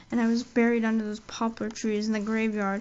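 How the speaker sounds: usual speaking voice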